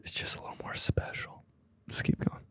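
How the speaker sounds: soft unvoiced whisper. Real, not acted. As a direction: whisper it aside. Whispered aside.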